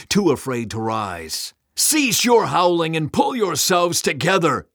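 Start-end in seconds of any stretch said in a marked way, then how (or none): 1.7-4.7 high, loud furious command from Satan; bellowing. A narrator's reading. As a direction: bellow at a cowering army. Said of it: "Satan bellowed".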